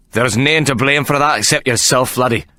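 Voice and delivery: a Scottish bruiser growling a threat. A narrator's reading. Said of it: "Figgins growled".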